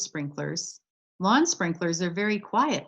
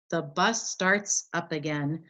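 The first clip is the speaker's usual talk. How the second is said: plain reading voice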